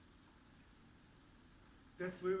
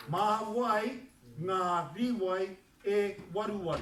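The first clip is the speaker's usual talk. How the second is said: a bit slow, louder repeat of the sentence; 3 semitones higher, 5 dB louder, slower than usual. Shown as a demonstration. Teacher checks the class can hear it, slow and louder. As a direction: say it again more smoothly. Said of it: "can you hear it".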